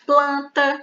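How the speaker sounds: planta said slowly, each consonant sounded; teacher spells planta aloud for the class; clear syllables. Shown as a demonstration. Teacher to students, planta said clearly and slowly. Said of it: "Por exemplo".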